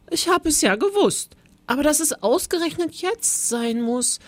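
high little-man lament; small voice. A narrator's reading. Teacher turning monster laments the timing, in small voice. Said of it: "rief er mit seiner kleinen, leisen Stimme".

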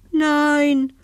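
high, anxious little refusal; scared Sina refusing to look at the ghost; fearful no. A narrator's reading. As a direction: refuse timidly, a touch scared. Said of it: "antwortet Sina ängstlich".

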